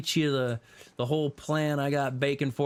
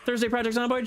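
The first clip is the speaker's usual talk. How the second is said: surprised; 11 semitones higher, faster than usual. Real, not acted. Surprised question in chat.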